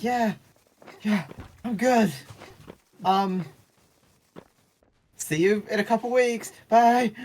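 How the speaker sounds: faking fine while mortified; awkward squeaky reply. Retold or imitated. Narrator assuring the ex she is fine, squeaky and awkward. Awkward goodbye after embarrassing moment.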